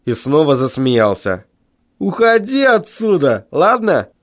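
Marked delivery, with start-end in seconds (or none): 2.0-4.1 high-pitched foreman brushing someone off; scoffing dismissal. A narrator's reading. Foreman sends the teacher away, scoffing. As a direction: wave him away, voice pitched up.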